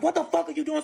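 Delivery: high-pitched retold outrage; mad, pitched well up without shouting; imitating mad boyfriend. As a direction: act out his angry disbelief. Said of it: "So he's mad".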